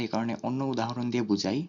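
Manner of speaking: ordinary talk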